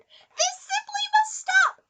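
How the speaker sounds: tiny mouse laying down the law; mouse shouting. Acted. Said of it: "he sat up and shouted".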